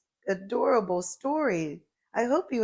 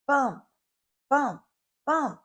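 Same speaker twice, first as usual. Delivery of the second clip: bumpy jolts; 7 semitones higher than usual. A narrator's reading. Bumpy ride sounds.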